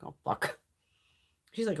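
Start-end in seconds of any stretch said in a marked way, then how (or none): 0.0-0.6 breathy, whispered "Oh, fuck" under the breath; whispered dread. Retold or imitated. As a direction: mutter it like you're dreading it. Reacting to mother's recommendation.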